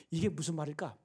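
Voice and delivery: normal talking voice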